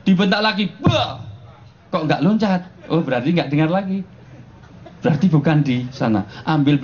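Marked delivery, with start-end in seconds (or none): 0.8-1.2 sudden high "Bah!"; high startle cry; mock shout. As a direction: yelp it to startle the grasshopper. Shouting at grasshopper in joke.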